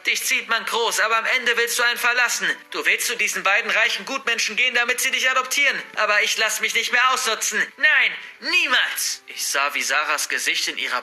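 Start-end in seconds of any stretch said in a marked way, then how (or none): 0.1-9.2 high, raging granny tirade; enraged, raised-pitch outburst; furious scream. A narrator's reading. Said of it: "schrie sie".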